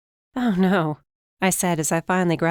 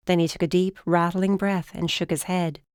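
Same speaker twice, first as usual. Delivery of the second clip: ordinary delivery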